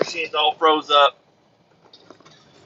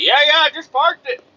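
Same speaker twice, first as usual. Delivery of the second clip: high-pitched dismissive imitation; mocked coworker; 11 semitones higher than usual. Coworker who ran the truck answering, mocking imitation. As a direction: mimic a clueless coworker brushing it off. Other driver's reply, imitated.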